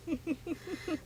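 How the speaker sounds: soft, high run of giggles; sinister chuckle. A narrator's reading. Moon dashes the boy's hope with a soft, high chuckle. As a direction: chuckle quietly in quick high pulses. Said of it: "and chuckled".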